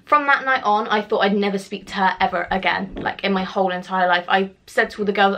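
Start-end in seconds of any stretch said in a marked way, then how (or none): none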